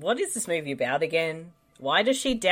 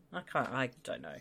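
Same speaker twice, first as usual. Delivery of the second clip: ordinary talk, nothing marked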